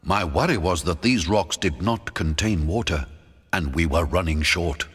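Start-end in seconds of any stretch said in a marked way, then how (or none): none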